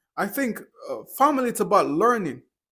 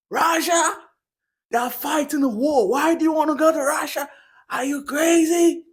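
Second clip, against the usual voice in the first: much higher and louder, the worried mother's voice; alarmed mom; 9 semitones higher, 5 dB louder than usual. Retold or imitated. Son imagines his mother's reaction to Russia, alarmed high voice. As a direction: play the horrified mom, pitch way up, slowing on the last line. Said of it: "she's gonna go".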